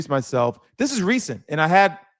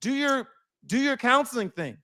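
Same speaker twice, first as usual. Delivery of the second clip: high-pitched mock exasperation; exasperated inner retort; 8 semitones higher, 3 dB quieter than usual. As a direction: push back in a raised, acted-out voice.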